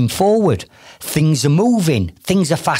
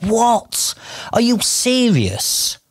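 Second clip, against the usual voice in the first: incredulous; 5 semitones higher, much slower than usual. Retold or imitated. Coach reacting to client's excuse.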